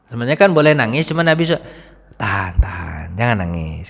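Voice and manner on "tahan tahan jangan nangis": soft, lower, soothing hold back tears; quietly calm the weeping companions; tender low plea; gentle restraint. Retold or imitated. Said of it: "kata Nabi".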